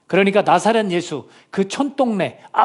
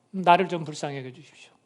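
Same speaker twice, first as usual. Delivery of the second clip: ordinary talk, nothing marked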